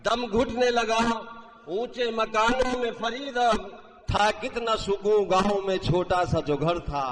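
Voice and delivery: declaimed Urdu verse; stately nazm recitation. Acted. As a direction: recite the couplet with grand feeling. Mushaira poetry recitation. (شاہانہ انداز میں نظم خوانی)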